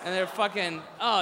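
usual speaking voice

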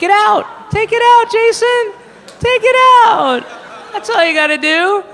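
yelled high and loud at Jason; screaming advice at the kid to take it out; mock shout. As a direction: bellow it like an exasperated coach.

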